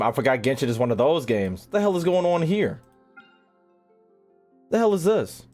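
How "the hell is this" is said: normal talking voice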